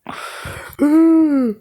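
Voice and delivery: long, very high strained sigh; exasperated sigh. Real, not acted. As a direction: let out a drawn-out high sigh of despair. Exasperated after the bad line.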